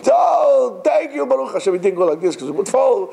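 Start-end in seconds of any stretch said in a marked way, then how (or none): 0.1-1.7 grateful relief. Retold or imitated. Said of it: "the voice saying".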